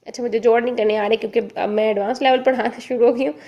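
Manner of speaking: ordinary talk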